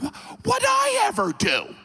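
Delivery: high-pitched mock outrage; indignant tsar. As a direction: play the wounded innocent. Ivan voiced in denial.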